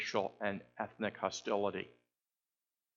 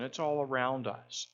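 ordinary talk

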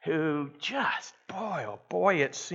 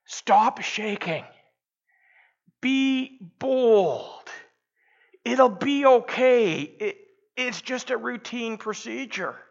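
a higher, lightly voiced nurse, slow and bossy at first; nurse's voice; 8 semitones higher than usual. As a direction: play the brisk nurse giving orders, a bit higher. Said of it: "The nurse said".

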